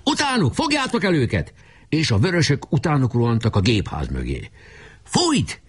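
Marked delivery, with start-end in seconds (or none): 0.0-1.5 high-pitched urgent order; excited rallying cry; battle command. Acted. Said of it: "Pásztor kiadta a jelszót". 5.0-5.6 high-pitched one-word cry; urgent commander's call; shouted command. Acted. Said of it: "kiáltotta Boka".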